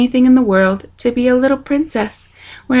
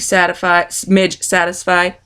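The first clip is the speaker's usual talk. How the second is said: plain reading voice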